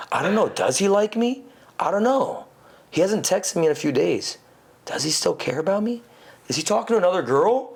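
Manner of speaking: high, fretting imitation of a worried woman; mock girl voice fretting over a guy's texts; anxious girl. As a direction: play the insecure girlfriend overthinking. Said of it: "Women love the idea of not knowing".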